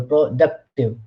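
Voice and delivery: "productive" broken up, stress landing on "duc"; stress on second syllable. Shown as a demonstration. Lecturer repeating the example for students, "productive" stressed on duc. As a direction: say productive in pieces, leaning on duc. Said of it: "stress is on second syllable".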